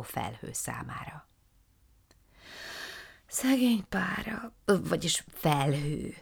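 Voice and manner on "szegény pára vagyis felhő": slow, high whispered pity; lamenting. Acted. Matild laments over the shrinking cloud, slow and whispered. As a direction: mourn the poor cloud under her breath. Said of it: "sopánkodott Matild".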